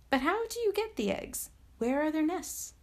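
high, eager little princess question; eager child. Acted. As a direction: ask like a thrilled child. Princess eagerly asks about nests.

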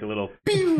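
high laser zap; quick laser sound effect; laser pew. Shown as a demonstration. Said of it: "I could do, like".

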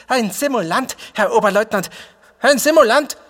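high, loud yell trembling with rage; malicious, furious shout. A narrator's reading. Squad leader denouncing a faker to the lieutenant, shouting furiously. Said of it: "schreit mit seiner boshaften Stimme zitternd vor Wut".

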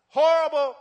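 a sudden loud, high mocking blast of "Horrible"; mock outrage. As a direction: throw the word out, loud and scornful. Mocking society's view of obedience.

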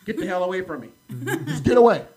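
loud, high exasperated outburst; acted-out fed-up yell, rising in force; snapping angrily. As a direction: snap at a pushy vendor, retelling it. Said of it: "I snapped on him".

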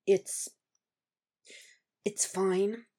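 soft, tightened, halting reassurance; hesitant and strained, lifting at the end; tight voice. A narrator's reading. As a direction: insist quietly through a tight throat. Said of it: "His voice goes tight".

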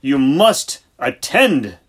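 "You must attend" slow, loud, every word weighted; portentous command. A narrator's reading. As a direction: lay down the law like a solemn decree. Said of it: "He raised a portentous forefinger".

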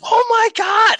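a high, loud oh my God; shocked shout. Retold or imitated. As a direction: yell it in shock. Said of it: "and we're like".

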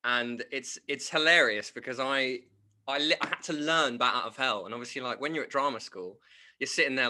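ordinary talk, nothing marked

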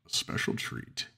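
low, slow, whispered treat; a creepy creature hushing its answer; monster whisper. A narrator's reading. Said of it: "it answered".

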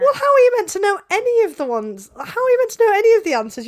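high, loud, fast exasperation; exasperated. Real, not acted. Host exasperated at co-host who says she can't know. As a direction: throw the question back, fed up.

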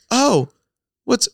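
high-pitched questioning teacher, lightly put on; imitating puzzled professor. Professor asks Grant what is going on, puzzled. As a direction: ask it as the interrupted teacher. Professor catches laughing student, retold.

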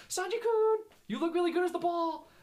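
put-on high, loud girlish coaxing call; Nami. Fan imitating a character flattering Sanji from the sidelines, high and coaxing. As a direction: sing out far above your own voice, imitating a cartoon girl.

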